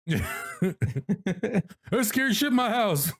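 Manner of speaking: words squeezed out through laughter; stretched out, giggling all the way; laughing while talking. Real, not acted. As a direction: laugh helplessly as you say it.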